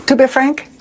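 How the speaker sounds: lightly hammed French accent; fake French accent. Shown as a demonstration. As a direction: lean harder into the French accent. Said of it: "I can't even do a fake French accent".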